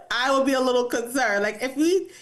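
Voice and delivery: ordinary talk, nothing marked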